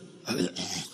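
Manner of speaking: imitated throat-clearing cough; mock nervous cough. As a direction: fake a small habitual cough. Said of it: "He just goes".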